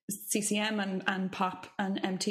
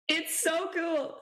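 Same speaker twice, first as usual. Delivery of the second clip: gushing; 8 semitones higher, much slower than usual. Real, not acted. Gushing finish.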